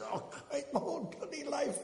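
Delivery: high, slow woeful moan; hammed-up lament of the arrested man; mock despair. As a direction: wail it like a man whose life is ruined. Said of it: "He says".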